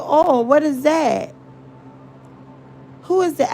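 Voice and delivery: high, puzzled imitation of the young lady; mimicking young lady. Retelling a young woman's confusion, high puzzled voice. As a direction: ask it like she has truly never heard of it. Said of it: "this young lady said".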